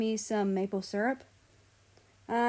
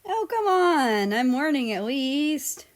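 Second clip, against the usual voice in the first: whiny protest; 7 semitones higher, 7 dB louder than usual. Acted. Sister whines at brother.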